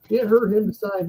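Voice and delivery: ordinary talk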